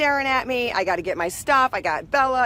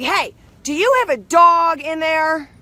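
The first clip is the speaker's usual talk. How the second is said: imitating the gate agent, higher and louder; mock stern gate agent; 6 semitones higher, 6 dB louder than usual. Gate agent confronting passenger about hidden dog, mock stern. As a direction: confront her like an official. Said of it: "She's like".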